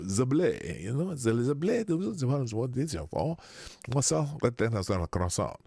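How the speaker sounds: hammed-up mock French; French accent. Streamer riffing on a chat member's name, mock French accent. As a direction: put on a silly French accent. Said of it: "do that in a French accent".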